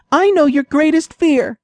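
mouse voice. A narrator's reading.

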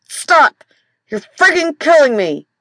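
high, loud pained cry; yelp of pain. Acted. Husband crying out as his wife touches his back, loud and pained. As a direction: yelp as if she hit the sore spot.

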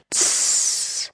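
soft unvoiced hiss; hissing tss. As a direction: let out a short hiss. Same noise again. (leises Zischen)

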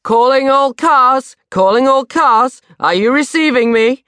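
hammed-up dispatcher voice; dad playing a silly radio operator; Dad's radio voice. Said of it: "He was going".

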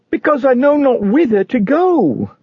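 high-pitched cry of helplessness; distressed burdened man. Acted. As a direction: answer high and lost, desperate for direction. Burdened man answers Evangelist.

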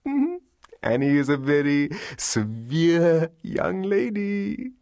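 high, drawn-out Arthur voice, playfully contrite; Arthur, coyly submissive. A narrator's reading. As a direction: draw it out slowly in a high, teasing mock lament.

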